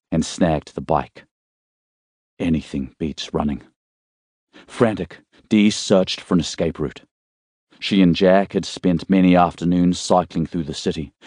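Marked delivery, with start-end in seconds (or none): none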